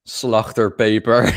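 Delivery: slow Dutch "slachterpeper" with a throaty ch; Dutch pronunciation. Shown as a demonstration. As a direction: say "slachterpeper" slowly as a Dutch word. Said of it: "in Dutch it would be".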